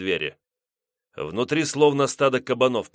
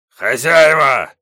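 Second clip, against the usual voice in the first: slow, loud bellowing call; growling shout; 5 semitones higher, 11 dB louder, much slower than usual. Acted. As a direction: bellow it out toward the house. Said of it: "рыкнул Гос".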